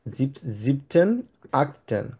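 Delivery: each German form drawn out slowly; teacher counts off the special forms slowly for the class; slow listing. Shown as a demonstration. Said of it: "এই যে চারটা দেখতে পাচ্ছি আমরা".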